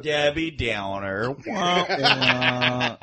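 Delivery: mock sad trombone. Mocking a glum cohost.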